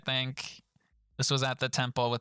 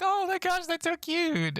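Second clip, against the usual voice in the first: gushing flight attendant. Retold or imitated. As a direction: squeal it like a delighted flight attendant. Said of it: "the flight attendant was like".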